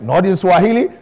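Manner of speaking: usual speaking voice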